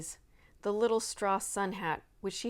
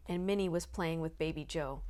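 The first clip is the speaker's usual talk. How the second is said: ordinary delivery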